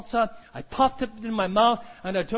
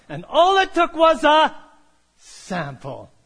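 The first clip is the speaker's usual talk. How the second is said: normal talking voice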